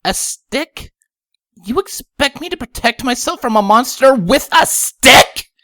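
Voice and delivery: high voice building to loud outrage; indignant high-pitched disbelief; incredulous. A narrator's reading. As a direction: start dumbfounded, end in a loud protest. Lyra dumbfounded, protests in high voice.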